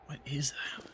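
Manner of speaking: hushed nervous whisper. Real, not acted. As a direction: whisper it, wary of something. Spotting a shadow in cave.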